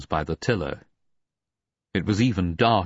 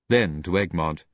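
ordinary delivery

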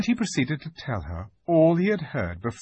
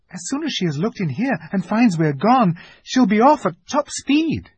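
high, loud, rising alarm; urgent warning; 11 semitones higher, 6 dB louder than usual. A narrator's reading. Susan warns Missus Beaver of pursuit, urgent. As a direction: build higher as the danger is spelt out.

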